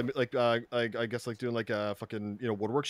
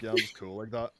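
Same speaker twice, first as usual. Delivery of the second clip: mock cool; 7 semitones lower, 7 dB quieter than usual. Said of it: "pretend you're sick as fuck and be like".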